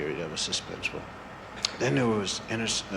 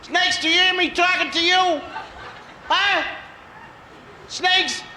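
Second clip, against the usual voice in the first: calling from far away; 12 semitones higher than usual. Acted. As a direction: call out to the snakes, pleading. Child pleads with snakes.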